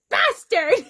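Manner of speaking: loud furious yell of a name-call; angry shout. Retold or imitated. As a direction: spit the insult out loud. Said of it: "I shouted angrily".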